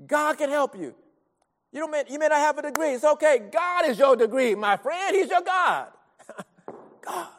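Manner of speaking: high, driving preacher's delivery; fervent sermon crescendo; impassioned preaching. Real, not acted. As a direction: press the assurance home, building to a high peak. Preaches with rising force.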